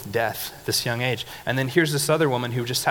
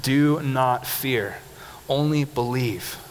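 slow emphatic; much slower than usual. Shown as a demonstration. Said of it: "If you're gonna underline any verse".

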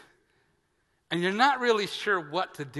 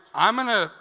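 threatening; 6 semitones higher, 9 dB louder than usual. Retold or imitated. Cocking fists at the man.

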